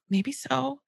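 soft, unbothered "Could be"; calm empathy. Shown as a demonstration. Mother answering a whining child calmly. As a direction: let it slide off you calmly. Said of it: "my little phrase".

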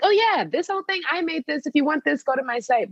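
high-pitched casual sales quote; breezy self-promotion. Retold or imitated. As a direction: toss off the pitch with easy confidence.

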